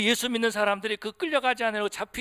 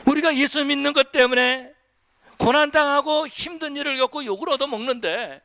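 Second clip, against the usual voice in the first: raised, ringing pulpit exhortation; fervent preaching; 4 semitones higher, 4 dB louder than usual. Acted. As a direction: preach it out to the whole church. Preacher exhorts congregation at full force. (힘있게 외치는 설교)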